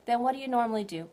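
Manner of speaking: normal talking voice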